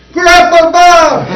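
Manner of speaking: high, drawn-out excited yell; screaming children. Retold or imitated. Schoolchildren greeting their classroom volunteer at the door, screaming. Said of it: "they're all screaming".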